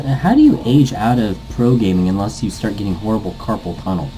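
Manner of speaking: ordinary talk, nothing marked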